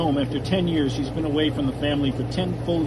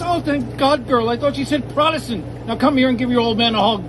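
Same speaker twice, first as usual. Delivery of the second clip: high, loud overjoyed dad; relieved father hugging his daughter, high and loud; 6 semitones higher, 4 dB louder than usual. Acted.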